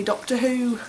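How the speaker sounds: lightly put-on English accent. Shown as a demonstration. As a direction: slip into an English accent for the name. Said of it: "I always go into an accent".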